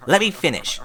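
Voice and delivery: stern controlled scolding; angry but controlled. A narrator's reading. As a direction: hold the anger in check, but let it show. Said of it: "in an angry but controlled voice".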